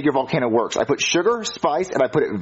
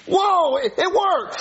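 high-pitched astonishment; amazed; 13 semitones higher, much slower than usual. Acted. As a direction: stammer in amazement as it suddenly works. Volcano suddenly erupts after his doubts.

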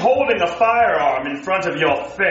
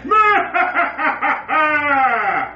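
evil cackle; 4 semitones higher than usual. Acted. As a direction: cackle like a supervillain. Villain laugh.

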